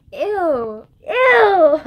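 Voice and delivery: loud, high disgusted laugh. Real, not acted. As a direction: squeal at how gross it was. Laughing at own burp.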